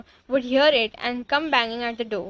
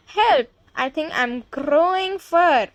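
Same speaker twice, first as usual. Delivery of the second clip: mildly raised mock scream; 3 semitones higher, 4 dB louder than usual. A narrator's reading. Boy discovers his fur, mildly screaming. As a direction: call for help in light alarm. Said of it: "A boy who's standing next to her screams".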